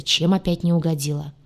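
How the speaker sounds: usual speaking voice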